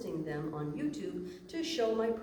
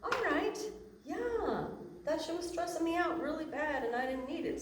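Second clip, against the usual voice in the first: relieved realization; 10 semitones higher than usual. Retold or imitated. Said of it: "And we go".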